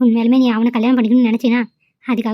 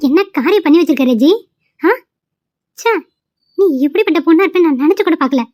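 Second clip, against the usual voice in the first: appalled friend, higher and a bit raised; shocked scolding; 4 semitones higher than usual. Acted. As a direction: lash out in disbelief.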